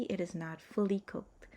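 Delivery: ordinary talk, nothing marked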